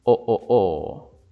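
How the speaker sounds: "O, o, o" slow, with a mid-open o; slightly open o. Shown as a demonstration. Teacher drilling the half-open o sound. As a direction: repeat the half-open o slowly. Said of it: "lo vamos a abrir un poco".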